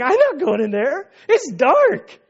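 high, jittery voice, refusing to go in; scared John. Retold or imitated. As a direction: play a nervous disciple balking at the dark tomb. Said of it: "John's kinda like".

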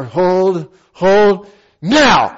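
drawn-out holds, then a sudden loud call; timed command. Retold or imitated. Instructor cueing skydiver's landing, drawn-out then sudden command. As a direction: build suspense, then release on NOW.

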